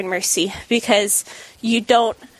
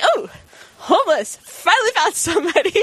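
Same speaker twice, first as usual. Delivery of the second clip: excited discovery; 7 semitones higher than usual. Retold or imitated. Excited at finding someone.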